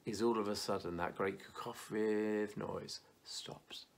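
soft and slowed, as if heaven went quiet; hushed telling of the silence in heaven; hushed narration. Acted. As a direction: drop to a near whisper. Describing heaven falling silent.

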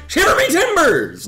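high, loud pirate exclamation; over-the-top pirate holler; hammed-up pirate cry. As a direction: yell it like a pirate in throes. Acts the term as climax cry.

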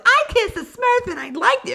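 mock Smurf singing line; squeaky Smurf. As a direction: chirp it like a tiny Smurf. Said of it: "one's like".

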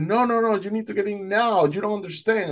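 high, insistent pleading; retold urgency. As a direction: press someone to act immediately. Boatman urges swimmer aboard.

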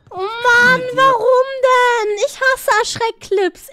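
high, drawn-out loud wail; distressed whining. Real, not acted. Streamer complaining after a scare clip, distressed wail. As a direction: wail in protest, dragging the words.

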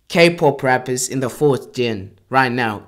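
ordinary delivery

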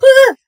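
short high scream of horror; shocked scream. Acted. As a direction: scream at the mirror. Sees her hair in mirror.

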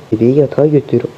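ordinary delivery

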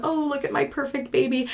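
high, soft coo of adoration; happy cooing. Retold or imitated. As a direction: imitate a new mother gushing softly over her baby. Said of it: "I would be so happy".

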